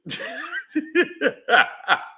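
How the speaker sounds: high chuckling burst; real amused laugh, running on. Real, not acted. Laughs at party story.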